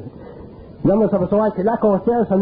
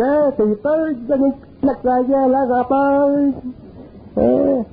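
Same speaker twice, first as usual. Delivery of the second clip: mocking inner voice; 7 semitones higher than usual. The inner voice teasing Jean-Paul after the fight, high mockery. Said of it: "t'as dit".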